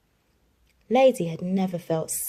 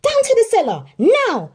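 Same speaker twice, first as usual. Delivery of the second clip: high, loud angry command; yelled order; 10 dB louder than usual. A narrator's reading. Aunt Sarah orders dogs to the cellar, angry. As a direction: order them down hard. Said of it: "she yelled".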